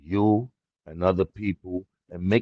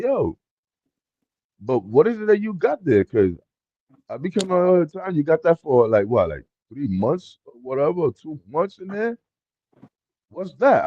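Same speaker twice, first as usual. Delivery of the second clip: higher, pushing questions; imitating curious brother; 6 semitones higher than usual. Brother visiting last week asks about the grow room, higher nosy voice. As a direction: play the nosy brother who keeps asking.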